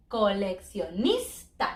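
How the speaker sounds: coleccionista slowly, each syllable separate, rising at the end; slow syllable demo. Shown as a demonstration. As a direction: model coleccionista slowly for learners. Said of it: "we have a couple of sandwiches".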